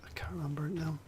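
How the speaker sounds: whispered softly to himself, the way you talk when you've forgotten something; breathy little whisper, almost to himself; whispered aside. Real, not acted. Trails off, muttering to himself.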